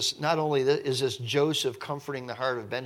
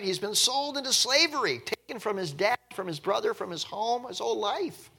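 rising pathos; 9 semitones higher than usual. Real, not acted. Preacher lifts voice in pathos.

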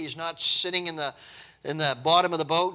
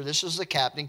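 ordinary delivery

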